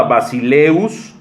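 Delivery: "basileus" said ba-si-LEUS; Greek word basileus. Shown as a demonstration. Preacher giving the second Greek word, pronouncing "basileus". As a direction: sound out "basileus" carefully. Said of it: "que se pronuncian".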